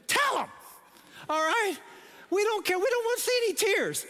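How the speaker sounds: high-pitched comic outburst; exasperated plea. Real, not acted. Speaker telling single ladies how to answer proposals, exasperated. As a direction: jump up high, insistent and pleading.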